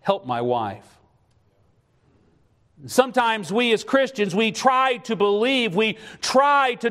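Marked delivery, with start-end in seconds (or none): 0.0-0.9 tearful prayer. Retold or imitated. A husband reliving his prayer for his sick wife, hushed and tearful. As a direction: murmur it like a man near tears, much lower. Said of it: "weeping and crying and saying".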